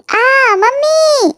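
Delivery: long drawn-out high wail "mummy"; girl screams for mom. Acted. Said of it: "ते की चिल्लाने की वजह से". (गंदगी लगने पर ज़ोर से खिंची हुई चीख़)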